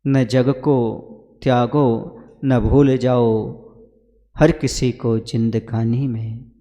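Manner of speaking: slow, measured chanting of verse, fading at the end; verse recitation. Acted. Guru reminds devotees of a couplet, slow recitation. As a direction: recite it slowly, letting it trail into softness.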